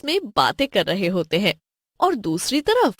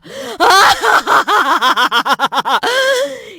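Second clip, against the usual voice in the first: loud high witch cackle; evil cackle; 10 semitones higher, 6 dB louder than usual. Acted.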